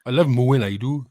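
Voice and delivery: Welsh cow voice. Pretend. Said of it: "Welsh cows say".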